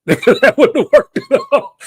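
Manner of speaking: high, slow words through laughter; laughing off the bad New York accent. Real, not acted. Laughing at the cabbie idea.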